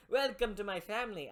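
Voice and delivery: put-on terrible Italian accent. Godfather welcoming recruits into his family, hammy Italian accent. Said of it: "I'm doing a terrible Italian accent".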